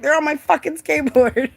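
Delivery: high, loud retold comeback; exasperated retort. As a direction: shout back in mock exasperation. Exasperated answer to her yelling.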